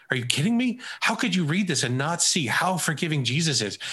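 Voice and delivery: stunned that anyone could miss it; dumbfounded disbelief. Retold or imitated. Mentee to his coach, amazed and incredulous. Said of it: "he looked at me dumbfounded".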